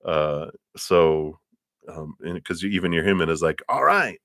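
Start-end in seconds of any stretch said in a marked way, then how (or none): 3.7-4.2 mildly excited, higher-pitched cheer; pleased exclamation. Acted. Tarot reader voicing the client's human side, pleased. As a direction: let it rise high, then drop, pleasantly won over.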